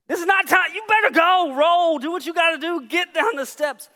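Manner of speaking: high, loud, frantic orders; worked-up shouting. Retold or imitated. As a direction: yell urgently to get the kid moving. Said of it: "I'm so worked up".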